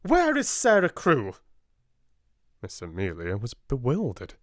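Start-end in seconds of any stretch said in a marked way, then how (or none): 0.0-1.4 high, loud, fierce demand. Acted. Miss Minchin demands Sarah of her sister, fierce. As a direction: bark the question at her sister. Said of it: "voice was almost fierce".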